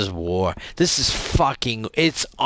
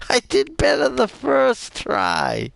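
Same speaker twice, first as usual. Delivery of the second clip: giggling at own failure, pitched up; laughing; 14 semitones higher than usual. Real, not acted. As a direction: laugh through the words, high.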